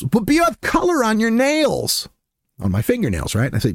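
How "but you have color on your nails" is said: slow, high child imitation; little girl. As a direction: puzzle it out like a small kid. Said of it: "She goes".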